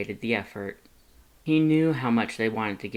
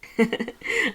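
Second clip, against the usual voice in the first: short high teasing giggle; 12 semitones higher, 3 dB louder than usual. A narrator's reading. Lust teasing Frisk about the gift, high giggle. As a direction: giggle at the flustered human. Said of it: "Lust giggled".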